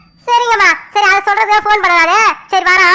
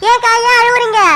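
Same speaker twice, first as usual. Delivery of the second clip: higher-pitched worried question; alarmed concern; 5 semitones higher than usual. Acted. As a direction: ask in alarm why she is crying. Child alarmed at crying elder sister.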